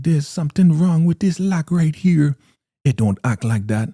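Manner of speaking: high, put-on complaining voice; loud, high-pitched mock fretting; fretting Elder Mother. Elder Mother struggling with her lock, high fretting voice.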